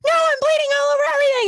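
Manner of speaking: high, fast hysterical wail; crying and yelling. Retold or imitated. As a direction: wail it in a rush, hamming up the panic. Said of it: "I was just crying and yelling".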